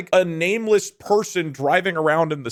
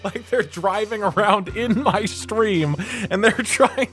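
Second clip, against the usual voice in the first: usual speaking voice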